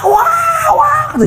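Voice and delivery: imitating mother's wailing. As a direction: wail it out like his mother does when there's trouble. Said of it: "kalau ada masalah nangis".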